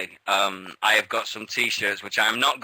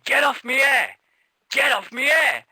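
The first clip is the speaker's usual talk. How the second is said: high, loud imitation of an outraged wrestler; mock yell; 14 semitones higher, 5 dB louder than usual. Wrestler telling opponent off for touching his hair, high mock yell. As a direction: yell it as a mock protest, pitched right up. Acting out wrestler's protest, twice.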